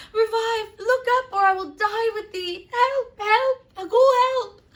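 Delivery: frantic mother crying out very high and loud; hysterical mother. Acted. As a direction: wail as the grieving mother. Lady Capulet finds her child.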